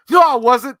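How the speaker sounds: high, loud heated comeback; heated yell. Retold or imitated. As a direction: shout back, offended. Bruce Willis yelling back.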